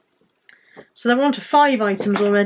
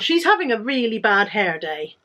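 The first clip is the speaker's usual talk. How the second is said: usual speaking voice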